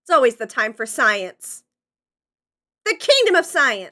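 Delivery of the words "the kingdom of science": loud, high hyped declaration; excited proclamation. Acted. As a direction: proclaim it like a rallying cry. Hyped science catchphrase.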